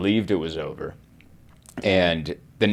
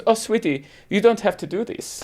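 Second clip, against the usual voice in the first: imitated high, sweet cooing voice; soothing nurse; 11 semitones higher than usual. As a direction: comfort an upset patient in a high voice. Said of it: "sees how upset you are, and she goes".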